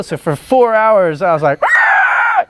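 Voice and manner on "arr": piercing high goblin screech at full volume; shrieking goblin war cry; goblin scream. Retold or imitated. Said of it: "screaming top of my lungs".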